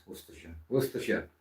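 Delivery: ordinary talk, nothing marked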